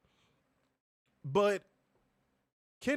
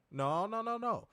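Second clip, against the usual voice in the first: ordinary talk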